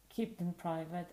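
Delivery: normal talking voice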